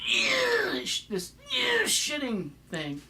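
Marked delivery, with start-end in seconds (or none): 0.1-0.8 high squeezed "yeah" noise; strained emphatic yelp. Retold or imitated. Showing how a politician emphasizes words, high strained wail. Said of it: "words are emphasized with this". 1.5-1.8 high squeezed "yeah" noise; strained emphatic yelp. Retold or imitated. Showing how a politician emphasizes words, high strained wail. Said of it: "words are emphasized with this".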